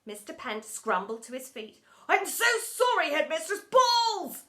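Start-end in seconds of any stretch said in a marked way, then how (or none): 2.1-4.4 loud, high apology ending in a cry; flustered apology. A narrator's reading. Teacher apologising to headmistress, flustered blurt. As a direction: apologise loudly, then blurt the last word.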